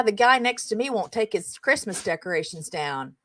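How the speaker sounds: ordinary talk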